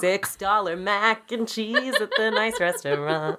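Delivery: slow, drawn out through laughter; laughing confession. Real, not acted. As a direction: laugh through the words, stretching them.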